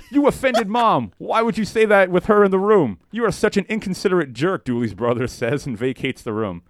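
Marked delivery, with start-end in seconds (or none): 0.1-4.6 angry scolding. Acted. Brother scolds Dooley in story.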